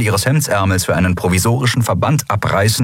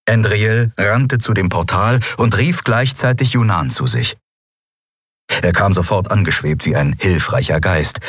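usual speaking voice

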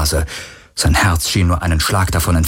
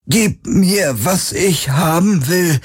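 high, slow, icy strained voice; strained, cold as marble; 10 semitones higher, slower than usual. A narrator's reading. Possessed Kirai demands the artifact, strained and ice-cold. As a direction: force each word out coldly. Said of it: "mit angestrengter Stimme, kalt wie Marmor".

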